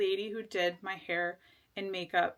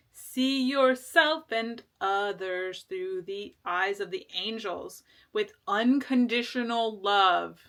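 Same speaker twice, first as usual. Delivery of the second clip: card reading voice; 4 dB louder, slower than usual. Acted.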